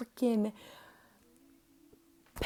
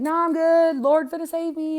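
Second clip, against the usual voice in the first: stubborn refusal; 7 semitones higher, 8 dB louder than usual. Retold or imitated. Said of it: "he's like".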